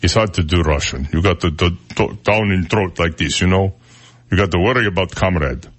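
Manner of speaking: put-on Russian accent down in the throat; throaty attempt at a Russian accent; mock Russian accent. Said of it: "The one I can't do is Russian".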